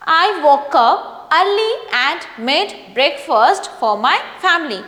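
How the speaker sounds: slow, clear read with a falling end at the full stop; reading with proper intonation. Shown as a demonstration. Teacher reading a paragraph aloud for students, slow with clear intonation. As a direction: read it slowly, letting the voice drop at the full stop. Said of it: "proper intonation का use करके".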